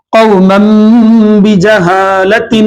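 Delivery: "بجہالت" drawn out slowly after "قوما", tanween turned to meem; slow tajweed recitation. Shown as a demonstration. Teacher reciting the example slowly for students, tanween as meem. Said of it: "اور ایک تنوین کی مثال".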